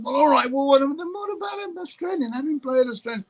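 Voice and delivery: very high-pitched imitation of someone arguing; retold exasperated producer. Producer pushing for an Australian actor, mock exasperation.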